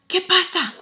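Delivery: high, loud mock yell; mad man bursting in. Man opens the door on the yelling, mad. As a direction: imitate an alarmed man calling out. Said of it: "he's mad".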